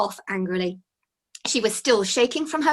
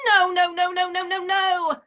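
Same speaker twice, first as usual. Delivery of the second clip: high, distressed wailing nos; sobbing; 9 semitones higher, 4 dB louder than usual. Acted. Fairy losing her beeswax, high distressed wail. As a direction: cry out in dismay. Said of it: "Penelope sobbed".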